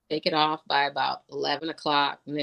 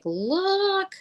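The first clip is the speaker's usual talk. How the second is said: high excited squeal; delighted high call. Retold or imitated. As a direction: squeal it like a giddy kid. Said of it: "I was all excited".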